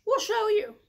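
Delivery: high, loud eager cry; excited goats. Acted. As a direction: shout it while leaping down. Goats leap down.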